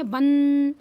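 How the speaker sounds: बन with a long humming n; drawn-out anusvara. Shown as a demonstration. Teacher showing the first syllable, held nasal. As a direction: hold the n of बन slowly. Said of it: "तो इसको पढ़ेंगे".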